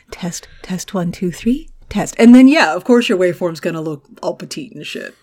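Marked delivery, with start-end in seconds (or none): 0.1-2.1 mock whisper imitating him; whispered mic test. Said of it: "'Cause you're always like".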